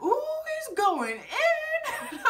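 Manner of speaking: high, drawn-out gossip squeal; scandalized, delighted whoop; gleeful reaction. Retold or imitated. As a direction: stretch it out with laughing delight. Her delighted reaction to the confrontation.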